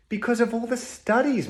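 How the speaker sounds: sudden high squeak. Acted. Puppet leaps up in pitch.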